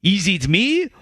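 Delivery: hammed-up slow French accent. Host quoting a French Canadian researcher's tweet, exaggerated French accent. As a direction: read the quote in a put-on French accent. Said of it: "my French accent that I perfected".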